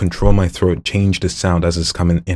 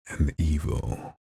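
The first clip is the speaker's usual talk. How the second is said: soft sinister; 3 semitones lower, 8 dB quieter, much slower than usual. Acted. Voice demo reel.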